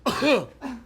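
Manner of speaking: exaggerated fake cough. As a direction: cough it up on purpose. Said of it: "fake cough".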